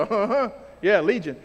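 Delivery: knowing uh-huh, yeah, legion; knowing recognition. Shown as a demonstration. Preacher voicing Mark's first audience hearing legion, knowing tone. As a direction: nod along like you know exactly who they are. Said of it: "when they hear legion".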